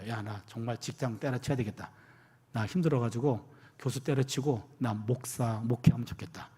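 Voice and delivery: low, soft retold phone confession; weary confiding. Exhausted professor phones pastor friends, imitated low weary confiding. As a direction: confide wearily, voice dropped. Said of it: "너무 힘들었습니다".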